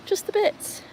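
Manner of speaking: mock dog voice begging mum; voicing the dog. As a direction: speak as the greedy little dog. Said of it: "She's like".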